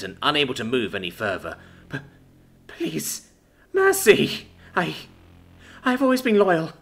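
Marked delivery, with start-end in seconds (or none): 1.9-6.7 high, stammering plea for mercy; desperate cry. A narrator's reading. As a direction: beg desperately, voice leaping high and breaking into bursts. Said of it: "she cried out in a final burst of desperation".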